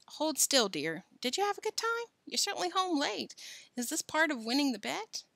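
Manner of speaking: high-voiced mother chatting; fussing mother. A narrator's reading. Billy's mother washing his face, fussing. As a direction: fuss over a child in a high voice.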